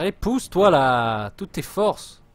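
loud, slow push-them-on shout; rally the troops with a yell; shouted urging. Real, not acted.

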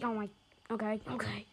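ordinary talk

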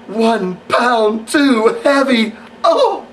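high, gasping fake dismay; mock horror. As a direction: play scandalized with a drawn-out, rising wail. Mocking the divas' fear of weigh-ins.

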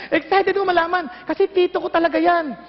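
ordinary talk, nothing marked

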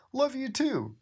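high squeaky mock mumble; sleepy Bendy. Reader voicing Bendy answering Cuphead sleepily, high mock mumble. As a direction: murmur it in a cartoon voice. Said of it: "Bendy mumbled".

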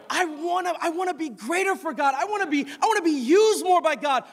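high, pressing preacher's plea; urgent, high-pitched sermon; impassioned preaching. Real, not acted. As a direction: plead your case to the congregation, high-pitched. Preaches at full force.

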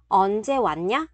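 ordinary talk, nothing marked

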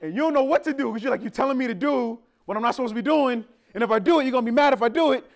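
high, loud, rushed confusion; loud, fast, high kid in a bind; frantic kid. Retold or imitated. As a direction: panic over the mixed message. Panicked kid reasoning aloud.

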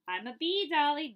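loud and high, bursting with excitement; excited bee. Acted. Child playing bee calls to Dolly, loud and excited.

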